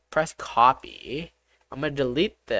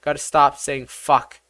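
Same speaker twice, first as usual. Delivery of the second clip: ordinary talk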